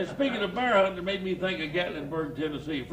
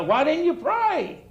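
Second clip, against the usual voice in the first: teasing preacher; 9 semitones higher than usual. Retold or imitated. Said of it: "the preacher was a teased him".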